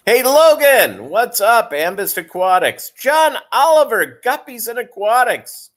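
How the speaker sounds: loud shout-outs to the chat; calling out greetings. Real, not acted. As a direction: call each name out like a host.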